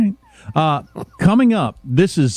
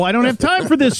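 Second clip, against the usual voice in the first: mock exasperated shout; 8 semitones higher, faster than usual.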